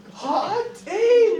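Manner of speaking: high, loud comic exclamation. Real, not acted. Lecturer reacting to the scene with a high loud exclaim. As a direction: blurt it out amazed.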